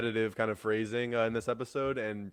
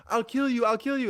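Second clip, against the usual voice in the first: high, loud mock threat in imitated accent; Angel Loosa's accent; 13 semitones higher, 7 dB louder than usual. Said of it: "over and over again in his accent".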